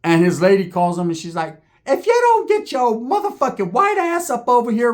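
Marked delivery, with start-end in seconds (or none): 1.8-4.9 put-on high-pitched scolding girlfriend; imitated angry wife. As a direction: nag him home in a high, mocking voice. His lady phones, ordering him home.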